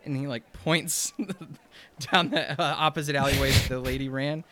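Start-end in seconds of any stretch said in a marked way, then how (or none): none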